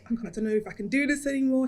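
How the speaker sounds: rushed despairing words; fast. Retold or imitated. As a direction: blurt out giving up. Despair at workload.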